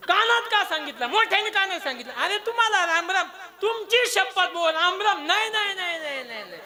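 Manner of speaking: heated quarrel. Acted. Kauravas dispute the whispered greeting, heated quarrel. Acted quarrel over the secret message.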